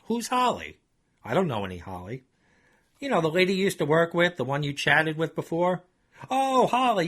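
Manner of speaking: lightly voiced nervous Chuck; Chuck's voice. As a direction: play dumb, then laugh it off. Chuck dodges the question.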